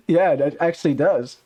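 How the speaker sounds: usual speaking voice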